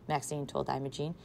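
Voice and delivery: plain reading voice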